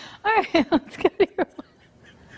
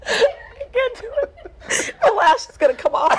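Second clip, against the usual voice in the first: laughing; 8 semitones higher, faster than usual. Real, not acted. Laughing anchor.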